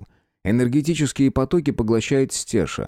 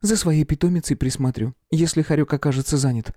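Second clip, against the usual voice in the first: put-on young woman's voice; 6 semitones higher than usual. A narrator's reading. Princess voiced by narrator.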